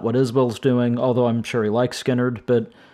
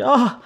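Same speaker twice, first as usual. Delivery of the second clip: delighted laugh; 10 semitones higher than usual. Real, not acted. Delighted reaction to [name] playing.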